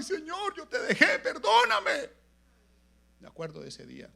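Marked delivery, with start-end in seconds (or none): 0.0-2.1 loud, very high wail; acted tearful begging to God; imitated crying plea. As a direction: mimic a weeping penitent crying out. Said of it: "con sus brazos así puestos y lloraba".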